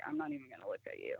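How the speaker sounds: fast, low muttered aside under the breath; quick low mutter. Retold or imitated. To herself, avoiding the grinning lady: fast low mutter. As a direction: rattle it off low, eyes away.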